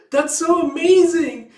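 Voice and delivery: high, loud, drawn-out squeal of awe; thrilled gushing. Acted. Young fan hears they really are world walkers, thrilled. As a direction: gush it loudly like a starstruck kid.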